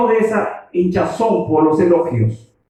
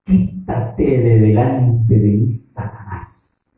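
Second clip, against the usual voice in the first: slow, lower, deliberate command; Jesus firmly rebuking Peter, slow and lower; stern rebuke; 7 semitones lower, slower than usual. Retold or imitated. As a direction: drag out each word as a stern rebuke. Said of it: "Qué le dice".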